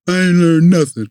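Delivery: imitating his angry younger self; mad kid. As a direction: spit out I ain't learned nothing like a fed-up boy. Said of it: "You know, I'm mad".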